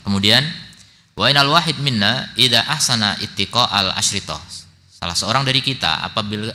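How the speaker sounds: loud, measured classroom reading with translation; reading a text aloud. Real, not acted. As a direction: read out the book firmly, phrase by phrase.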